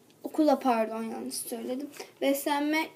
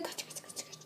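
hissed run run; urgent whisper; 17 dB quieter than usual. Acted. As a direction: whisper them to flee. Urgent hushed escape. (fısıltıyla kaç)

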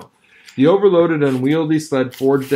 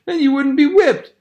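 put-on much higher, babyish woman's voice; Mercedes cooing; 14 semitones higher, 3 dB louder than usual. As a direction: coax the dog tearfully. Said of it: "she cried sympathetically".

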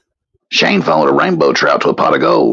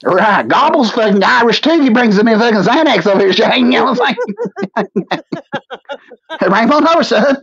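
high-pitched comic Irish voice; Irish accent; 8 semitones higher than usual. Acted. Telling Shane who brings the Xanax over, mock Irish accent. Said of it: "Shane with an Irish accent".

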